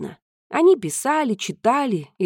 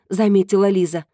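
ordinary delivery

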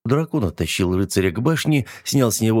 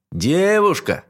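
higher, more insistent call up; louder call; 9 semitones higher, slower than usual. A narrator's reading. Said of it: "принялся кричать".

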